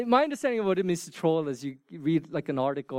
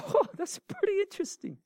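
high, mock-amazed exclamation; mock surprise. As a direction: play at being amazed. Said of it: "I was like".

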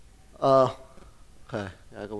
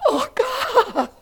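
imitated gasp; 6 dB louder than usual. Weeping woman touched by God.

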